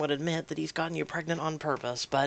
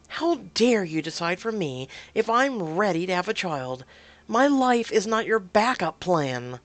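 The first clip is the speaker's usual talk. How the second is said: raised, indignant scolding; mad; 4 semitones higher, 5 dB louder than usual. Acted. Woman refuses to be partner's backup plan, angry. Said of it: "didn't think you would be so mad".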